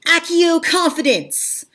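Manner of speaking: high, slow incantation; mock spellcasting. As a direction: cast it like a wizard's summoning spell. Mock-casting a Harry Potter spell.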